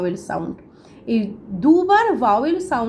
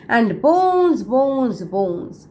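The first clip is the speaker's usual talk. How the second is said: fading poem recital; much slower than usual. Acted. Teacher reciting the moaning sea dog to students, fading away. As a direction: let each bones fade away like a moan. Reading poem lines aloud.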